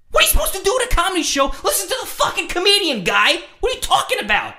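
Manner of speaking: high, fast incredulous yell; exasperated retort. Retold or imitated. Answering the drunk at a comedy show, exasperated yelling.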